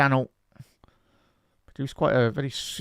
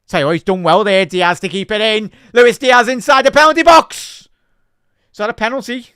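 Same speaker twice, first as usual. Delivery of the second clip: louder and higher with each phrase up to a shout; rising excitement; 7 semitones higher, 10 dB louder than usual. Real, not acted. Fan commentating a Liverpool attack, building to a shout. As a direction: get swept up as the attack builds.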